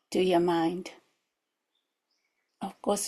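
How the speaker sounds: normal talking voice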